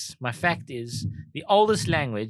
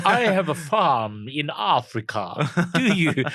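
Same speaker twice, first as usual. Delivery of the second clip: slow, exaggerated fake South African accent; Val Kilmer's accent; 3 semitones higher, slower than usual. Repeating Val Kilmer's line as a slow hammed-up fake accent. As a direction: ham up a Hollywood Afrikaner, slowly. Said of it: "Who taught you this accent?".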